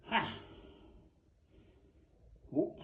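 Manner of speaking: ordinary delivery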